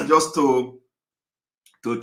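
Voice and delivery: coughing through the words; talking through a cough. Real, not acted. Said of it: "I'm sorry that I'm coughing".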